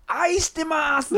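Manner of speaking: slow, high declared line; wrestler catchphrase. Retold or imitated. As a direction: announce it like a ring speech. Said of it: "なんかね". (決め台詞風に)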